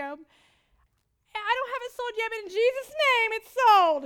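slow, high, building to loud; slow faith declaration. Retold or imitated. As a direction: proclaim it slowly, rising in faith.

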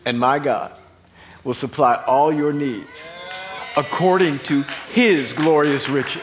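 slow, loud proclamation, swelling on "his glorious riches"; weighty divine promise. Retold or imitated. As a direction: declare it as God's own promise. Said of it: "what if it's God who's saying it".

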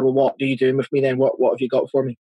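ordinary delivery

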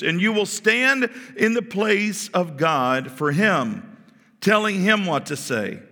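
high, loud, stern orders; angry. Acted. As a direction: lay down the law as an angered God. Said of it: "The Lord became angry with Moses".

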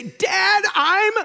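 put-on high, loud whining child; hungry kid. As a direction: play the starving kid begging dad. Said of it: "they'll look at me and say".

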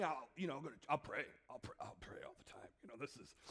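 low, fading into a whisper; desperate bargaining. Acted. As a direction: mutter a worn-out promise to pray. Desperate cold sufferer bargaining in prayer.

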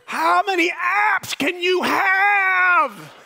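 high, slow mock exasperated shout; grumpy elder. Old person railing on young people, high slow shout. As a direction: complain loudly like a fed-up elder. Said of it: "rail on the young people".

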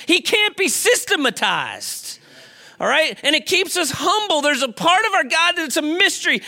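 high-pitched, driving sermon delivery; impassioned preaching. Real, not acted. Preacher telling congregation God can't be systematized, impassioned preaching. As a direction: preach it with rising conviction, leaning on the key words.

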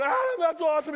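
high, loud shout over to a son; high-pitched, full-voiced cheer; excited yelling. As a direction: yell it in excited delight. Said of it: "We're yelling at each other".

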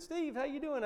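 put-on high, bright-pitched hello to an old friend; cheery phone greeting. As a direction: lift the voice way up like a happy surprise call.

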